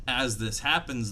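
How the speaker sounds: usual speaking voice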